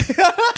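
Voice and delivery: sudden high laugh; high, real laugh bursting out; burst of laughter. Real, not acted. Laughing at own manspreading.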